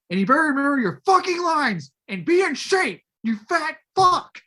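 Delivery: high, loud berating yell; mock yelling. Director yelling at Vin as he skulks away, mock yell. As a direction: scream it after him, hammed-up. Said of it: "yelling at him, berating him".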